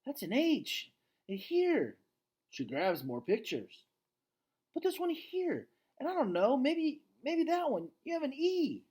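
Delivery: put-on very high, eager young voice; rising excitement of discovery; excited girl. Julia finds letters in the paintings, excited girl voice.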